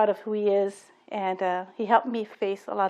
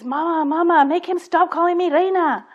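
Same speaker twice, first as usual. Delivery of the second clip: higher, louder, whiny plea to mom; a little girl begging her mother, higher and louder; pleading child; 7 semitones higher, 6 dB louder than usual. Retold or imitated. Child runs to mom nearly crying.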